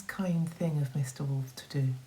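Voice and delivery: ordinary delivery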